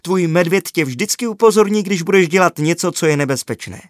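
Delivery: high and loud with delight; excited young girl voice. A narrator's reading. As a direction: gush about the clever bear.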